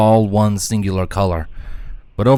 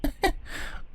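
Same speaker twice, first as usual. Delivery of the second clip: soft breathy chuckle; a small quiet laugh; amused little laugh; light chuckle; 12 dB quieter than usual. Acted. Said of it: "Twilight chuckled lightly".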